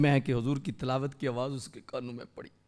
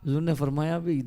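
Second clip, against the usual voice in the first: ordinary talk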